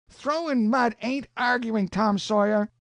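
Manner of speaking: put-on high-pitched, protesting young voice; a boy insisting he's right; indignant boy Huck.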